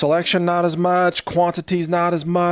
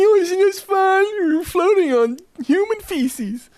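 very high, slow singsong mockery; put-on blissful falsetto; happy oblivious kayaker; 3 dB louder, slower than usual. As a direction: gush in a silly high-pitched voice.

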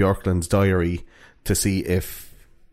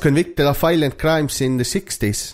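higher, lilting fake Finnish accent that drifts Welsh; mock Finnish accent; 5 semitones higher than usual. Podcaster describing a suspect to co-host, comic Finnish accent. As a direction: put on a bad sing-song Finnish accent. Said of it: "That sounds a bit Welsh".

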